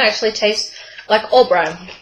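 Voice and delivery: speaking between small bites; talking while chewing. Real, not acted. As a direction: mouth partly full of snack. Seen 3: Holds snack up first, then hand at mouth eating while talking.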